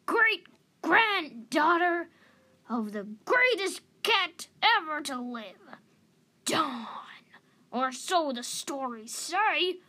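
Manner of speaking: very loud, high, croaky declaiming; crabby elder booming out a revelation; exasperated old cat. A narrator's reading. Old cat telling the youngster who she is, loud and emphatic.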